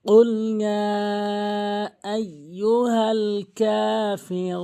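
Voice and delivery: very slow, drawn-out tajweed; Quranic recitation. Shown as a demonstration. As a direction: chant the verse slowly, holding long vowels. Teacher recites the verse as model.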